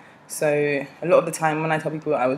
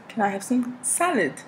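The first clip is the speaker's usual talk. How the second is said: put-on higher-pitched polite English accent; 7 semitones higher than usual. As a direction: repeat the request in a careful English accent. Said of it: "with my English accent".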